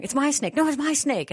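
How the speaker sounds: high-pitched imitation of squabbling kids; arguing young boys. Mother mimics her sons fighting over a snake, high bickering. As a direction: act out two little boys bickering. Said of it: "but they're arguing, already arguing, going".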